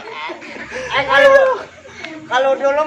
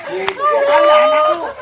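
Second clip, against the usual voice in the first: shocked retort; 12 semitones higher, 3 dB louder, slower than usual. Acted.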